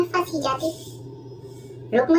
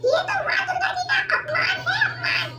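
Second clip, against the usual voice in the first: high, put-on old woman's voice; squeaky elderly saas, grumbling; old mother-in-law. (ऊँची आवाज़ में बूढ़ी सास)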